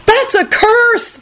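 high, drawn-out outraged cry; furious yell. A narrator's reading. Boys jumping up at Alex's curse, yelling furiously. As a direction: protest slowly at a very high pitch, furious. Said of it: "they yelled furiously".

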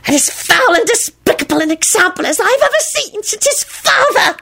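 high, venomous creature screech; vituperative elf. A narrator's reading. House-elf condemning the boy and his father, spitting contempt. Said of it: "Weezer spat out so vituperatively".

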